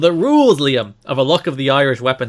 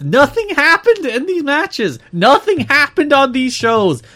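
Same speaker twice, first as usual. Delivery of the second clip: exasperated; 12 semitones higher, 7 dB louder than usual. Real, not acted. Exasperated rant about empty month.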